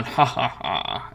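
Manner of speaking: slow, deliberate mock-evil laugh; hammed-up fiend chuckling in triumph; the fiend gloats with a slow laugh; slow villain laugh. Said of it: "he will say".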